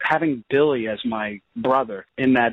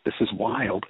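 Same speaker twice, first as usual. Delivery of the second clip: stunned inner thought; 3 semitones lower, 5 dB quieter than usual. Retold or imitated. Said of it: "and I just thought".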